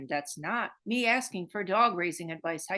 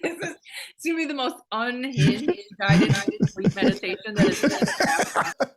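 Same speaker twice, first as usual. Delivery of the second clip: loud, talking through laughter; laughing; 4 semitones higher, 7 dB louder than usual. Real, not acted. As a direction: let the laugh carry the words. Laughing about the meditation.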